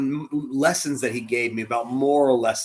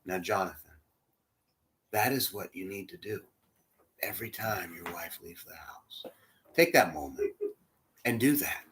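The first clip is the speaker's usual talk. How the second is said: low, soft, half-whispered counsel; soft fatherly advice; 6 semitones lower, 8 dB quieter than usual. Retold or imitated. Old mentor advises the younger man, softly and low. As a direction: lean in and pass on a secret. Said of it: "he says".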